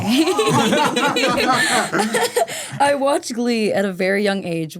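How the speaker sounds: laughing a little while confessing. Real, not acted. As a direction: giggle through the admission. Laughs admitting watching Glee young.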